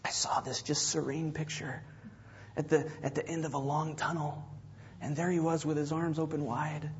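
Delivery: hushed, breathy mock-reverent whisper; whispered parody of a starry-eyed testimony; mock dreamy whisper. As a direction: send up the dreamy near-death story in a whisper. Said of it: "Tell you what people today say".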